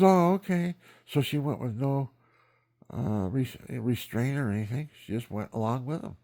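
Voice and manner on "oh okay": high, small, compliant voice; meek young woman. Retold or imitated. Grandmother meekly accepting the grandfather's order, high and small.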